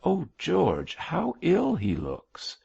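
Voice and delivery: high, drawn-out dismay; slow, worried exclamation, pitched well up; alarmed concern. A narrator's reading. Mab sees the bishop come out looking ill, alarmed.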